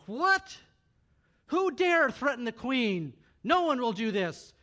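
shouting, "What?" leaps up high, then loud and slow; a king roaring in fury at the threat; outraged shout. Acted. King learns queen is threatened.

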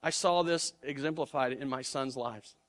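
ordinary talk

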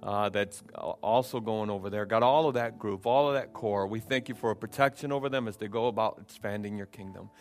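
quiet, earnest prayer voice under music; soft prayer. Real, not acted. Pastor blesses the offering in a soft, quiet prayer. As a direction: pray softly over the offering.

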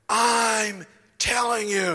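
imitating a booming preacher; vociferous preacher. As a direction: hammer it home. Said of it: "very vociferous".

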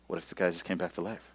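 very soft, quick, low murmur; soft, hurried confiding question; hushed aside. Retold or imitated. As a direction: slip it secretly to the girl beside you. Confiding in girl sitting next to him.

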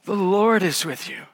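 low, soft voicing of an angel; a hushed, solemn angel; angel's greeting. Preacher voicing the angel greeting Mary, low and hushed. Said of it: "and he goes like this".

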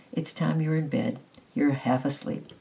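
ordinary delivery

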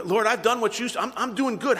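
a high, mildly whiny complaint; whining. Retold or imitated. Listener complaining to the Lord, lightly whiny. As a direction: whine a little about being good. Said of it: "kind of a little bit whining".

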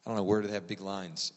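ordinary talk, nothing marked

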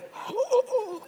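high, hammed-up gasp of the congregation; mock shocked gasp. Congregation hearing the pastor quit, mock gasp. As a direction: gasp as if scandalized. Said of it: "Some of you going".

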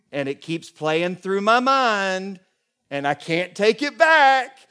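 exasperated replay building up; mock self-reproach. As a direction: beat yourself up out loud. Retold self-reproach in story.

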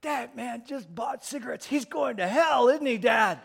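put-on high kid voice building to loud; shouting little boy. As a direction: announce it to the crowd like a child. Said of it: "Little boy shouts to the crowd".

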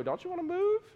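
a needling high voice luring the soldier from his post; tempter voice. Retold or imitated. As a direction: sweet-talk the guard into sitting down. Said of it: "somebody can get up in my face and go".

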